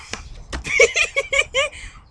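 high, rapid giggling; real high-pitched laughing fit; high giggle. Real, not acted. Still cracking up at the story.

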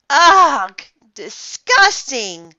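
loud, drawn-out cry of disgust. A narrator's reading. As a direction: recoil loudly in disgust. Narrator's disgust at giving mouth-to-mouth.

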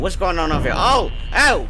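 normal talking voice

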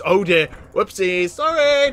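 usual speaking voice

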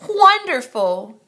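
delighted cry. A narrator's reading. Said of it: "Count Infinity cried".

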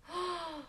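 sharp intake of breath in wonder; a soft, surprised gasp; awed gasp. Real, not acted. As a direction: gasp at the sparkle. Amazed at glistening ink.

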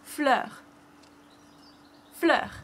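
fleur said flur, falling; French fleur. Shown as a demonstration. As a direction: model fleur twice for the kids. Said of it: "how to say flowers".

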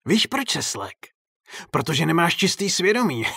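high, gloating clown voice; taunting clown. A narrator's reading. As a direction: needle him over a guilty conscience. Clown taunts the startled director.

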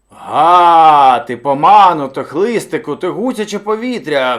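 very loud roaring scolding; bear shouting. A narrator's reading. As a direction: yell abuse at a latecomer like a furious bear. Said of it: "кричав на нього ведмідь".

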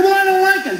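snapping back at the smoker, high and insistent; high insistent protest. Retold or imitated.

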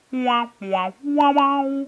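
high, loud sad-trombone wails; mock wah wah. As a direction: wail a mock fail fanfare. Mock fail fanfare over rare.

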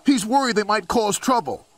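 role. Acted. Henry answering insult, high voice.

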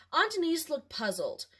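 plain reading voice

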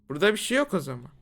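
ordinary delivery

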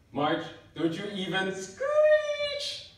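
slow and loud, drawing out screech; loud slow warning. Acted. Worried friend warning March not to race, slow loud warning. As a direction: warn him sharply, stretched out.

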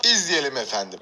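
low, formal newsreader delivery; TV announcer voice. Shown as a demonstration. Youtuber slipping into a TV presenter voice for viewers. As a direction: intone it like a television presenter. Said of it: "Televizyon spikeri gibi oldum".

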